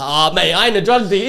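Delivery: indignant protest. Retold or imitated. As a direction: protest loudly that you're no dealer. Denying being a drug dealer.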